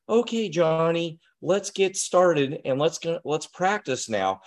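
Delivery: mock adult talking down to a child; silly voice. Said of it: "Are you talking in a silly voice?".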